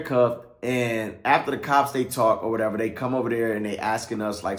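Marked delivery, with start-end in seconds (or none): none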